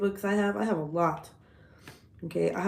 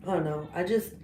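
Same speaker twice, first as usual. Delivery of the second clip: normal talking voice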